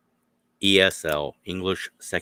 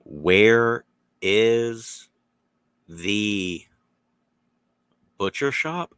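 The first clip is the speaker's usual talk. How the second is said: each word slowly spelled out; slow and clear; 3 semitones higher, 6 dB louder, much slower than usual. Retold or imitated. Local asking the way, slow and clear. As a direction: speak slowly for a learner. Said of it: "slower or slowly and correctly".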